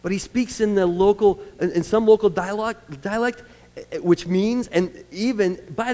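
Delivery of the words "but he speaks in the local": usual speaking voice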